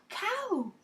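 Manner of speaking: bad man's demand. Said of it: "The men say".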